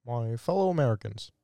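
low, measured presidential impression; lightly voiced presidential address; Barack Obama. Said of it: "Did I do my Obama last time?".

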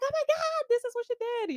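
high-pitched mock panic on the phone; alarmed retold exclamation. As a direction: retell it as a high, frantic cry to your sister. Panicked call to sister, retold.

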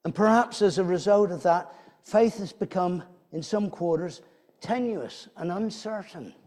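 normal talking voice

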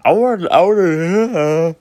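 words stretched through a yawn. Real, not acted. Apologising to fans while yawning through the words. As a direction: talk slowly mid-yawn. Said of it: "sorry for yawning".

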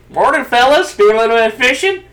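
put-on hammy frontier-town greeting in an Old West accent. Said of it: "everybody in that game uses the accent".

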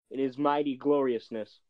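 ordinary talk

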